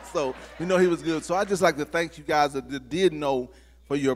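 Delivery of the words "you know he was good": normal talking voice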